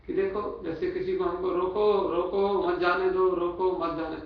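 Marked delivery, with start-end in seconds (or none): 1.5-4.2 रोको on its own, then मत जाने दो together; urgent रोको, break, then मत जाने as one phrase; pause after रोको. Shown as a demonstration. Preacher showing how one sentence means stop him, break after रोको. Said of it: "सेंटेंस एक ही है".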